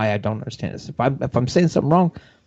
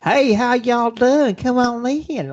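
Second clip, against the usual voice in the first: mock Southern welcome; 11 semitones higher, much slower than usual. Imitating backwoods host greeting.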